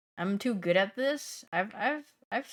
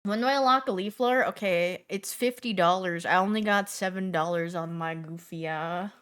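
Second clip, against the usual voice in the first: usual speaking voice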